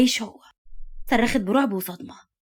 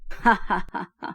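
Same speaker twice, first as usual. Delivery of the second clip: malicious laugh. A narrator's reading. Villain laughing at his accomplice's accusation, malicious. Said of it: "ضحك موتسد بغل".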